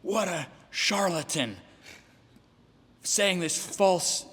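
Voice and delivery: high, very slow sneering mock voice; a hammed-up online attacker sneering. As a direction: drag out the insult like a smug critic. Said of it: "No, it's saying".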